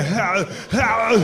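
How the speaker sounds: drawn-out mock groan; two long, put-on howls; imitated movie grunt. Clearing out a public bathroom, mock groaning. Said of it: "That's a good noise to make".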